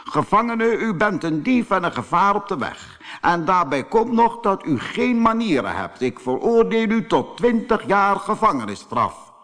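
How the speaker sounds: high-pitched judge passing sentence. A narrator's reading. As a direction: pronounce the verdict as a stern judge. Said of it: "zei de rechter".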